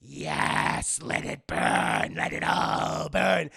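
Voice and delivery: slow, rasping low gloat; resentful announcer. Retold or imitated. Resentful announcer watching the blimp burn, gloating rasp. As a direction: savour the destruction, drawn out and loud. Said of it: "announcer who was very resentful".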